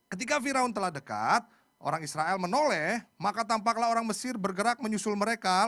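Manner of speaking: loud, high declamatory Bible reading; scripture reading. Acted. Preacher leads congregation in reading Exodus, declamatory. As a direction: read it out boldly to a congregation.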